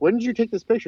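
asking with mock surprise; surprised question. Flash asks Marv Wolfman about the photo, lightly mock-surprised. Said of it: "So he's like".

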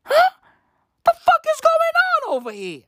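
high, disbelieving squeal; baffled outburst. Retold or imitated. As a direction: shriek it in total disbelief. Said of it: "I'm like".

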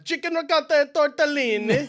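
loud, high mock Italian accent; exaggerated Italian-accented restaurant order; put-on Italian accent. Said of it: "I switch to the accent".

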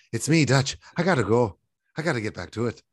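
put-on lively Irish accent on the phone; a cheery Irish lilt, quick goodbye; dad calling home in an Irish accent. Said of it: "why is your Irish accent better than mine?".

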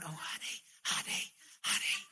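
put-on whispered, half-hearted call to a child; mock gentle parent. Parent barely warning a little demon near the street, mock whisper. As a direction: feebly whisper the warning for comedy. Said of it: "you're not gonna go".

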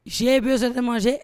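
loud mock pleading for money; mock plea for fifty dollars; begging fan quote. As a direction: ham up a needy beggar. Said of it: "Bref, on m'a dit".

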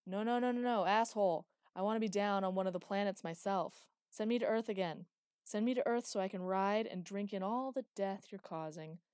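loud, insistent refusal; loud protest. A narrator's reading. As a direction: push back hard at him. Villain protesting to Hyperion.

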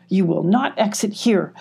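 ordinary delivery, nothing marked

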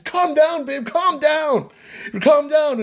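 high-pitched, drawn-out plea to calm down; urgent pleading. Retold or imitated. Husband holding his bride back by the waist, urgent high pleading.

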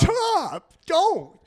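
high, loud cry of protest; panicked protest. Acted. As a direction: cry out sharply to cut them off. Mock breakdown, co-host cries out.

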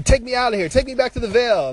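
high-pitched imitation of pleading; urgent high mock plea; mock begging. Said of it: "fucking begging and crying".

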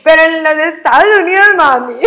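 high, loud, laughing quote to mom. Real, not acted. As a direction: giggle it out to mom. Still laughing, playful quote to mom.

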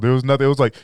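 ordinary talk

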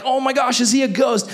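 high-pitched mock panic; freaking out. Disciples on the boat seeing Jesus on water, mock freak-out. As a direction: act out alarmed onlookers, pitch jumped right up. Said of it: "everyone's freaking out".

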